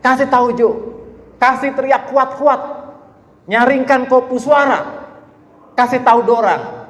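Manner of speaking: high Manado dialect proclamation; lively Manado accent exhortation. Retold or imitated. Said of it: "ini pakai logat Manado nih".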